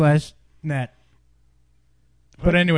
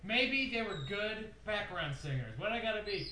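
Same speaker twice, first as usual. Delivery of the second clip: calling from the next room; far off; 4 semitones higher, 15 dB quieter than usual. Pretend. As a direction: voice drifting in from afar. Talking from outside the room.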